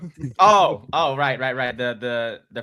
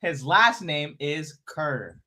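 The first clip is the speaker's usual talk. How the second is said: slow, measured name reveal; deliberately slow; much slower than usual. Shown as a demonstration. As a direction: draw each word out for the joke. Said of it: "Say it slowly".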